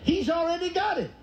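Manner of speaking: very high-pitched preaching cry without extra volume; triumphant exclamation. Real, not acted. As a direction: proclaim it in a high, exultant voice. Preacher's triumphant climax, repeated for emphasis.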